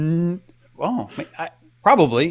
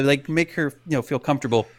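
ordinary talk, nothing marked

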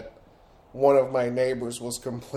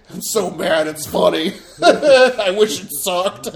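angry laughing; 5 semitones higher, 6 dB louder, slower than usual. Pretend. Voicing the angry neighbors.

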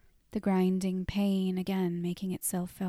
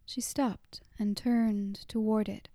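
usual speaking voice

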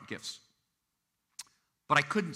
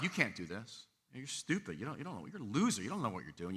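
imitated belittling; 4 semitones lower, 9 dB quieter than usual. Critics someone has heard their whole life, muted imitated belittling.

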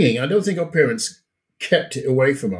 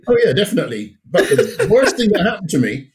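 laughing; 6 semitones higher than usual. Real, not acted. Laughing recalling the rum.

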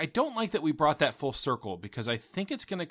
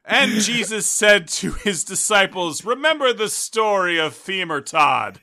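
loud, slow scripture voice; mock Bible reading; 5 semitones higher, 6 dB louder than usual. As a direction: intone it like a preacher. Mock Bible story telling.

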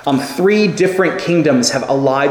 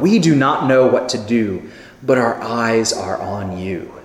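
softer, lower and slow, like a hushed prayer; quiet reverent prayer; 4 semitones lower than usual. Shown as a demonstration. Preacher voicing the king's prayer, hushed and reverent. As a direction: pray it gently, eyes on God. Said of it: "what a beautiful statement of faith".

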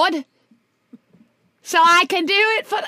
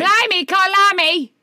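hammed-up Cockney, louder; mock Cockney exclamation; 4 semitones higher, 5 dB louder than usual. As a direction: bellow Cor Lummy like a Cockney cabbie. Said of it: "your Cockney accent is perfect".

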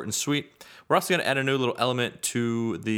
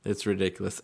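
usual speaking voice

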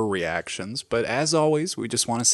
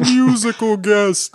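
much higher, louder, slow announcer call; 11 semitones higher, 8 dB louder, much slower than usual. Acted. Host announcing the musical guest in a booming voice. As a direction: boom it like a TV announcer. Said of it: "drawn out".

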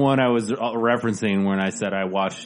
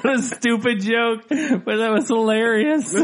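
high-pitched laughter through words; cracking up mid-sentence; laughing; 14 semitones higher, 4 dB louder than usual. Real, not acted. S2 cracking up at joke.

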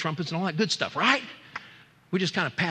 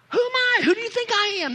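very high, raised-voice demand; insistent rebuke pitched way up; the Lord's indignant challenge; 6 dB louder than usual. Acted. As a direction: cry it out as an outraged almighty. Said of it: "He talks to me like that".

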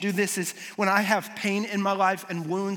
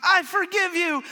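"I forgive you" much higher and slower, hammed-up movie tears; mock tearful forgiveness; 10 semitones higher, 4 dB louder than usual. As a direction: overplay a sappy film moment of forgiveness. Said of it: "with tears in your eyes".

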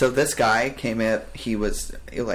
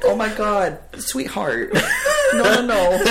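high, laughing no no no; mock reassuring; 10 semitones higher, 6 dB louder than usual. Retelling how he calmed the panicking employee, high and laughing. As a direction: coo at him like a frantic big sibling.

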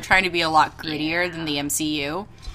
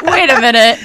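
laughing disbelief; 4 semitones higher, 8 dB louder than usual. Real, not acted. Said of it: "I was like".

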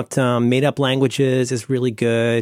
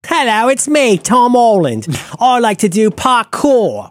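hammed-up British accent, high and loud; mock British accent, stretched out and proclaimed; Tom Holland; 8 semitones higher, 5 dB louder, slower than usual. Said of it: "speaking in, with his British accent".

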